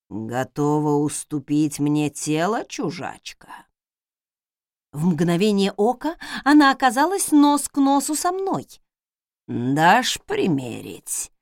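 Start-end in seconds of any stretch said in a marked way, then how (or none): 0.1-3.7 low, slow ghostly menace; eldritch horror. A narrator's reading. Ghost asks for her body. 9.5-11.3 very slow, low ghostly voice; eldritch horror. A narrator's reading. Ghost asks for her body.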